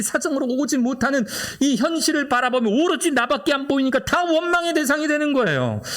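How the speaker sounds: soaring pulpit fervor; impassioned preaching. Acted. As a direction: lift the voice high and press the point home. Sustained high preaching.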